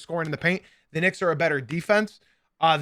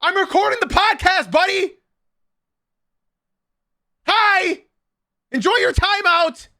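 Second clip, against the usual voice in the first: much higher and louder, curt dismissal; annoyed scolding; 12 semitones higher, 5 dB louder than usual. Real, not acted. Telling Adolfo to enjoy his timeout, annoyed scolding. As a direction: send him off to timeout sharply.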